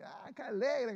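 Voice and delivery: high, bright mock-cheery exclamation; lightly imitating an overly happy person; cheerful person. Preacher imitating an always-cheerful churchgoer, high mock-cheery voice. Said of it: "siempre está contenta".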